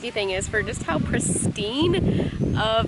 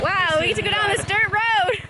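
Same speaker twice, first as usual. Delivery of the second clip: excited; 7 semitones higher than usual. Real, not acted. Excited about the dirt road.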